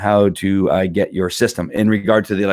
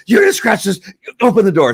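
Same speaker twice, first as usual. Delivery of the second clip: high, hurried owner voice; mock flustered owner; 10 semitones higher, 5 dB louder than usual. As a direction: play the owner rushing to open the door. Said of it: "I went crazy there for a second".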